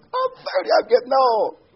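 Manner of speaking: very high, hammed-up panic; mock scared. Father imitating his thirty-year-old son, mock scared. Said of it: "He's scared".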